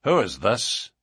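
curt question from a rival. A narrator's reading. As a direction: demand it curtly. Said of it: "he asked curtly".

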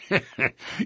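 amused laugh from the lodge boss. Acted. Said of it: "McGinty laughed".

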